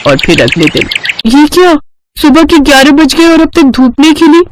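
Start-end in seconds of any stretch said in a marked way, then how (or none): 1.3-4.4 higher-pitched, taken-aback bride; dismayed surprise. Acted. Bride finds no sun at eleven, dismayed. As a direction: ask in chilly disbelief.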